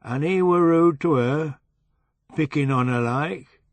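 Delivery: put-on drunk Yorkshire man prodding, West Riding accent; a lightly drunk West Riding accent. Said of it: "He had a flat West Riding accent".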